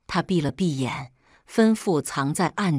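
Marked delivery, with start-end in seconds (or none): none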